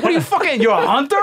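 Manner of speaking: very high mock disbelief; incredulous cop. Cops repeating "hunter" in disbelief, very high. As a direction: repeat it back in shock like a cop. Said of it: "They're like".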